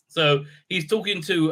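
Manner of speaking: usual speaking voice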